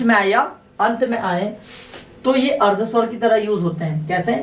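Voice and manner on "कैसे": ordinary delivery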